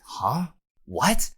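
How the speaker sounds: high, rising disbelief; acted surprise. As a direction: react in mock shock. Kobe realising Garp was stabbed.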